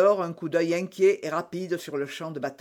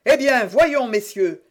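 high, raised-voice demand; impatient shout; 5 semitones higher, 5 dB louder than usual. A narrator's reading. Jussac demands their decision a third time, shouting. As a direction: call out, pressing for an answer. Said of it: "cria pour la troisième fois Jussac".

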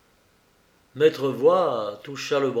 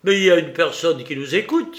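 higher, louder, quick exclamation; crying out; 4 semitones higher, 7 dB louder, faster than usual. A narrator's reading. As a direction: burst out in sudden alarm at a listener. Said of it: "s'écria-t-il". (s'écrier vivement)

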